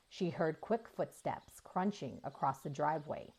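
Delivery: ordinary delivery, nothing marked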